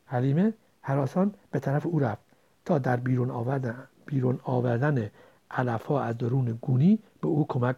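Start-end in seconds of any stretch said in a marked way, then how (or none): none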